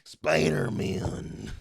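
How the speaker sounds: loud, very slow growled announcer; wrestler voice. Pretend. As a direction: stretch the name out big. Wrestler voice impression continued.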